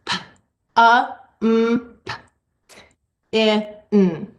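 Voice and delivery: P, A, M, P, I, N said one sound at a time with long gaps; teacher segmenting a two-syllable word aloud; segmented sounds. Shown as a demonstration. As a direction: sound out each phoneme separately. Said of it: "I'm going to do a two-syllable word".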